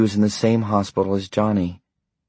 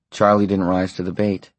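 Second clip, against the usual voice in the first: plain reading voice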